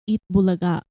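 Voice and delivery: normal talking voice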